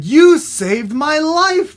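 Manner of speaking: high, loud, drawn-out thanks; hammed-up amazed gratitude; grateful exclamation. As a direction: gush to your rescuer. Frog thanks the dog.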